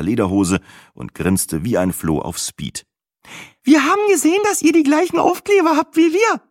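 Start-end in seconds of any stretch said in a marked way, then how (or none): 3.6-6.4 far higher, louder, gushing chatter; excited chatter. A narrator's reading. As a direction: babble it out like an overeager hen. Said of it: "schnatterte sie aufgeregt".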